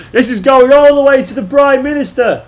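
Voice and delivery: high, loud mock-Australian outrage; hammed-up cartoon Australian, indignant and high; Simpsons Australian. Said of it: "it's the best Simpsons episode ever".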